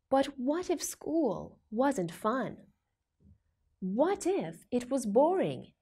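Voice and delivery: ordinary delivery, nothing marked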